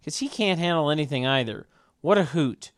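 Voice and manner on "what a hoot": normal talking voice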